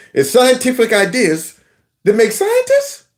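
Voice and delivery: "scientists" lifted high into a question; mocking question intonation. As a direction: parody an unsure claim, "scientists" jumping up. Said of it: "like it was a question".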